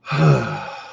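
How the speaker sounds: long, heavy sigh; exasperated sigh. Real, not acted. Shopper recalling a slow checkout line, exasperated sigh. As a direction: let out a weary breath. Fed up while waiting in line.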